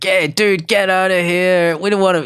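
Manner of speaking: a lightly voiced rowdy drunk brushing off the bouncer; rude bar patron.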